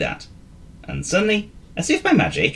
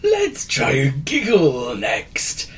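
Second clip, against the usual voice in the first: slow, a touch higher; light wheezy suggestion; 5 semitones higher, much slower than usual. A narrator's reading. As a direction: gently propose the next step. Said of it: "suggested Wizard Wheeze".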